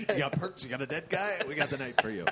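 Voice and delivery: pushy infomercial pitch; salesman pitch. Pretend. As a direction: sell it like a hype ad. Mock dealer pitch.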